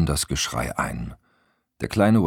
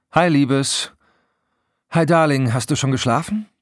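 high, loud and gushing; bubbly phone greeting; 10 semitones higher, 5 dB louder than usual. A narrator's reading. Best friends greeting each other on the phone, bubbly. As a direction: greet a dear friend effusively.

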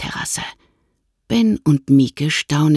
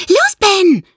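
put-on much higher, louder squeaky girl; excited squeal; 6 dB louder than usual. A narrator's reading. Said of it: "quiekt Mieke aufgeregt".

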